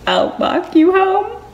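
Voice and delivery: high, loud, drawn-out squeal; swooning mock wail. Fan retells hero's offer to his love, swooning squeal. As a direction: stretch it out, overcome with delight.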